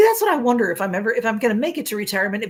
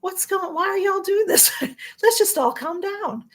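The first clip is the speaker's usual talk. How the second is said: high mock voice of a man begging them to calm down; hammed-up high pleading voice of the man pinned to the ground; pleading guy on the ground; 10 semitones higher than usual. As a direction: squeak it out high like a panicked guy trying to settle everyone. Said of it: "he's like".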